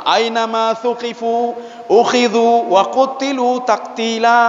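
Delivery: slow, drawn-out Arabic recitation; Quranic recitation. Acted. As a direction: recite the verse with long held syllables. (melantunkan ayat dengan lambat dan panjang)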